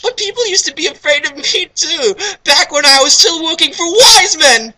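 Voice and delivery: put-on very high falsetto girl. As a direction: tell it in a squeaky girl voice. Voicing Knights, falsetto.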